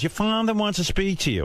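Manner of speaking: a hint of a summoning mother; mother's summons. Mother sends son to his father, lightly put-on voice.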